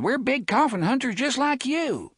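put-on high young boy calling across; a taunting kid shouting down the street. Boy taunting Jonas across the street, high calling voice. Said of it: "and called".

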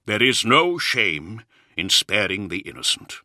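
plain reading voice

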